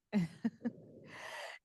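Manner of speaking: short sheepish laugh; embarrassed laugh. Real, not acted. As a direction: laugh off the slip. Laughs after losing her thread.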